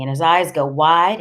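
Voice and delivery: plain reading voice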